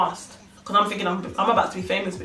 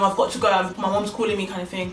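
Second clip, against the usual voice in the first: plain reading voice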